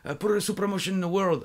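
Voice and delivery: high, hyped-up mock Japanese English; strong Japanese accent. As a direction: pitch it excitedly with a Japanese accent. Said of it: "avec un fort accent japonais".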